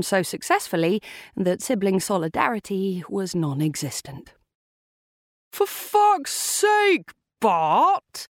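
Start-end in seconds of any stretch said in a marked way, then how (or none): 5.5-8.3 high, drawn-out angry yell; furious shout. A narrator's reading. Angry sibling yelling at brother Bart over his parking, furious shout. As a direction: shout it in outrage, stretching the words. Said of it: "he shouted at the Aston Martin's driver".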